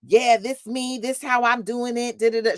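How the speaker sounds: high, cocky swagger. Retold or imitated. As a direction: imitate a cocky star brushing it off. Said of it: "she sounded so cocky".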